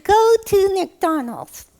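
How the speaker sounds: put-on high, slow childlike puppet voice; high-pitched, drawn-out silly guess; puppet character. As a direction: announce it proudly in a squeaky puppet voice. Puppet guesses what Jesus said.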